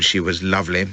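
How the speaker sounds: plain reading voice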